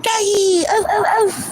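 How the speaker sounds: put-on high squealing doggy baby talk; cooing at a dog. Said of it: "It's like".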